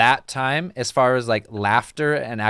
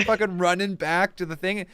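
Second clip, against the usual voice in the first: ordinary talk, nothing marked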